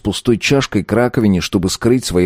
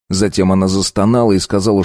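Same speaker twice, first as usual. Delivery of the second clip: ordinary talk, nothing marked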